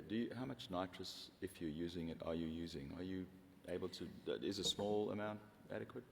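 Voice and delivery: ordinary talk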